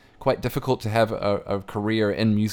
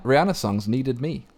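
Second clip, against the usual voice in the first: usual speaking voice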